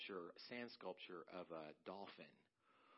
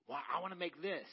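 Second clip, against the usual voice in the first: higher, louder mock protest from the grown son; a hammed-up bratty kid arguing back; whiny son; 8 semitones higher, 11 dB louder than usual. Son protests to his mom.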